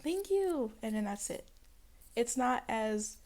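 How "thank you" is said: higher, breezy mock thanks; casual brush-off. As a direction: imitate someone who barely cares. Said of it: "casually be like".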